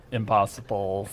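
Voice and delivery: "Impossible" with a long hissed S; soft, joking "Impossible" with drawn-out hiss; exaggerated sibilance. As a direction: stretch the S in "Impossible". Joking about P's and S's.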